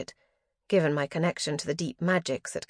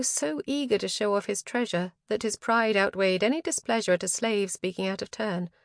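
plain reading voice

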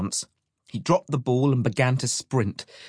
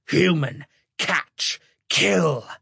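higher, harsh creature voice; monsters' war song; 6 semitones higher, much slower than usual. A narrator's reading. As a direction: screech each word apart like a hungry monster. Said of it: "ragged howls and screeches".

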